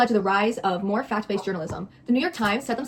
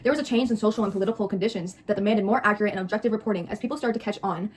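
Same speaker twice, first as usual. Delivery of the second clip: usual speaking voice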